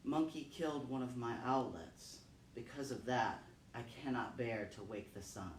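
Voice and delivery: mother owl telling the king why she grieves, softly; grieving mother owl. Acted. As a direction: let the grief pull the voice down. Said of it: "Mother Owl said".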